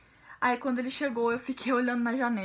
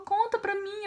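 tearful plea, much higher pitched; imitated crying mother; 8 semitones higher, slower than usual. Crying mother begging daughter to tell her, imitated tearful plea. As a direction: plead in a mock-weepy high voice. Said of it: "Minha mãe chorando".

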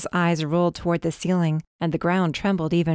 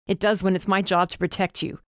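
ordinary delivery

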